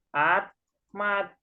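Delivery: "Atmat" split as At, pause, mat, with T sounds; T reading. Shown as a demonstration. Teacher shows how the spelling reads, T reading. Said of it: "Jadi dibacanya".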